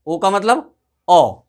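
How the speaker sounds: ordinary talk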